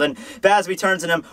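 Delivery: ordinary talk, nothing marked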